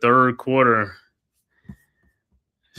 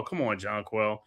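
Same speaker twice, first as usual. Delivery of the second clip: ordinary talk, nothing marked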